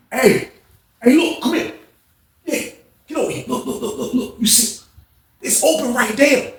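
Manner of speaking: high, eager coach calling a player over; animated coach. Retold or imitated. Coach pointing his player to the open back door, animated. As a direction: wave him over to the open door, keyed up. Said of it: "he was animated like that as a coach".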